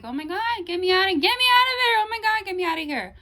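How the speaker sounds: high, very loud panicked plea; screaming. Retold or imitated. Reliving begging the shaman for release, screaming. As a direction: scream to be let out, re-enacting the terror. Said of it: "I was, like, screaming".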